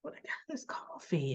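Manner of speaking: hushed, breathless whisper to himself; imitated whisper. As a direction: whisper it so nobody hears. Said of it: "he's looking like".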